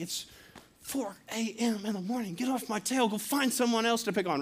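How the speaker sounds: retold frustration. Pastor retelling his gripe at the car behind, frustrated. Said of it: "I'm getting frustrated".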